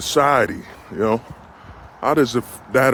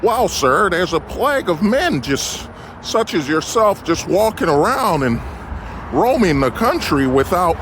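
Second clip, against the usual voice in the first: higher, earnest TV newscaster delivery; news reporter voice; 4 semitones higher than usual. Pretend. Vlogger parodying a news reporter, mock newscaster voice. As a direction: play a breathless news anchor on the scene. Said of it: "news reporter voice".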